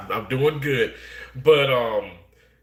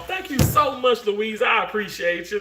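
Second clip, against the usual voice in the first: excited thanks; 9 semitones higher than usual. Real, not acted. Thanking chat viewer warmly.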